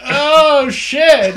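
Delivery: high, loud yelp; startled shout. Pretend. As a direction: cry out in surprise. Imagined patient going under.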